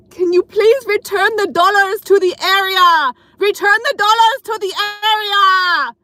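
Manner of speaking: put-on loud, high Russian-accented shout from the pole. Dancer on the pole yelling at a customer, loud Russian accent. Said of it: "But I was like".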